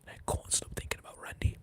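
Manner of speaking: whispered, fading announcer call; Joe Tessitore whisper. Retold or imitated. Streamer mimics commentator for a donation, whispering. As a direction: breathe it out like a hushed commentator. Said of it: "Or as Joe Tessitore would say".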